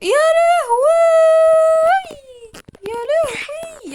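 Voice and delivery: high, slow, drawn-out lament; screamed wail. Real, not acted. Player failing at the game, screamed wail. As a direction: wail it loudly, then ease off.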